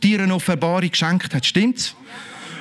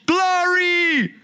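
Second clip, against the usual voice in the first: mock glory shout; 10 semitones higher than usual. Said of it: "dann rufe ich automatisch".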